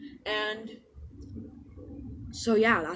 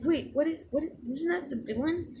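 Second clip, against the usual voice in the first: higher-pitched, startled questioning; puzzled surprise; 8 semitones higher than usual. Retold or imitated. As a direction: act out a bewildered double take. Startled question in acted dialogue.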